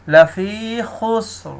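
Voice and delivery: slow chanted tajweed recitation; Quran recitation. Shown as a demonstration. Teacher recites the verse for students, slow chant.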